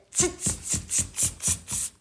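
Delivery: rapid string of voiceless tsks; voiceless mocking monkey chatter; monkey "tss" noises. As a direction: hiss short tss sounds like cheeky monkeys. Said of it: "they said".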